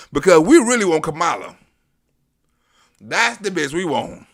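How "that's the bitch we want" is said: ordinary talk, nothing marked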